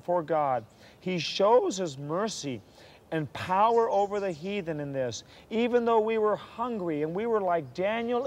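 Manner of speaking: high, drawn-out declamation of testimony; fervent preaching. Acted. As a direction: proclaim it slowly with soaring conviction. Declaims the book's closing passage.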